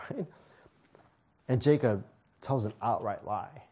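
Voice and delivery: ordinary talk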